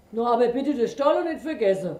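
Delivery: loud, quick grumbling bear; bear folk. A narrator's reading. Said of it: "brummte der Bär".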